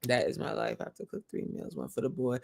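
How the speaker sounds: light Nigerian accent, kept low in volume. Shown as a demonstration. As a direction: drop into a quiet Nigerian accent. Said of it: "I love the Nigerian accent".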